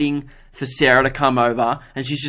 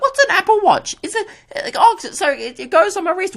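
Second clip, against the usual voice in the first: much higher, bewildered mock old-timer voice; baffled old people. Old shoppers puzzling over an Apple Watch, much higher bewildered mock voice. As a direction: lampoon confused elderly shoppers. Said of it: "Old people are like".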